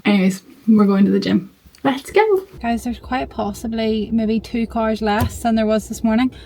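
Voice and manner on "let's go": bright rising "let's go"; excited cheer. Real, not acted. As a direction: pump yourself up. Hyping herself up for gym.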